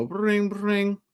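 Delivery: high mock ring ring; imitating a ringing phone; phone ring noise.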